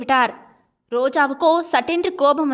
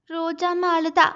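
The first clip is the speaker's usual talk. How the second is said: put-on higher-pitched small child's voice; little child; 4 semitones higher than usual. Little girl explaining to her father why she cried, childlike voice.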